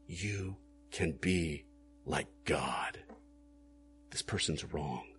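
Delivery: low, soft, tempting murmur; devil whispering. Acted. The devil tempting the listener, a low soft whisper. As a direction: lean in and tempt them quietly. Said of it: "he whispers in your ear".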